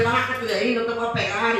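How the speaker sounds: softly coaxing a scared son off the roof; hushed, pleading reassurance; talking a frightened boy down gently. Retold or imitated. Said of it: "le decía".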